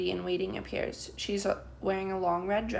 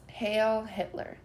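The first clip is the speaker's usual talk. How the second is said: usual speaking voice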